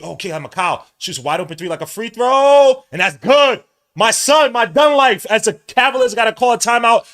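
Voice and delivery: very loud, high celebration call; ecstatic play-by-play shout. Real, not acted. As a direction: yell the big shot to the rafters. Celebrating a big three-pointer.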